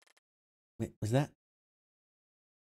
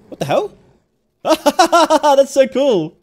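higher, much louder startled yell breaking into a laugh; excited shout; 14 semitones higher, 20 dB louder, much slower than usual. Real, not acted. Player startled by a game fiend, yelling and laughing. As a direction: shout in shock, then laugh with delight.

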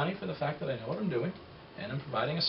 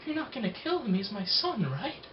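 a hint of a much higher frantic plea; imagined terrified parent; 8 semitones higher than usual. Parent pleading with knife-wielding stranger, mildly frantic. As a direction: beg for your child's life, lightly acted.

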